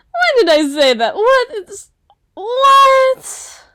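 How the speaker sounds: loud, half laughing, half crying in embarrassment; panicking at what she just said; flustered laughing wail. Acted. Flustered after her own slip.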